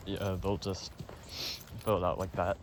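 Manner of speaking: blocked nose, a bit out of breath; stuffy nose. Real, not acted. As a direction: talk through a stuffy nose. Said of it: "I'm stuffy".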